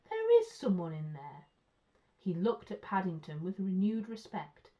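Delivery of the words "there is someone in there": loud policeman taken aback; astonished exclamation. A narrator's reading. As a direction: exclaim it with firm, loud surprise. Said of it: "exclaimed the first policeman".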